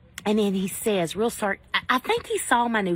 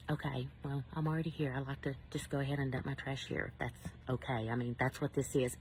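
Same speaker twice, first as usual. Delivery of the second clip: much softer, restrained and polite; forced calm politeness; 5 semitones lower, 11 dB quieter than usual. Retold or imitated. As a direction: swallow the anger, keep it soft and polite. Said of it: "So I continue to keep my composure".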